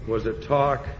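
ordinary talk, nothing marked

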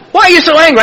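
loud, high-pitched mock challenge; exaggerated retold needling; pressing question. Counselor asks again, exaggerated.

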